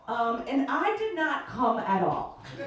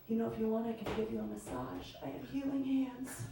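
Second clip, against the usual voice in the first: softly seductive date; 3 semitones lower, 10 dB quieter, faster than usual. Retold or imitated. Date offering massage, soft seductive.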